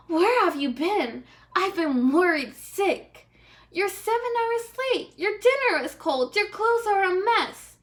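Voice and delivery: high, loud nagging wife; shrill-free high scolding, rising with each complaint; scolding princess. Acted. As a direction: scold him for coming home late.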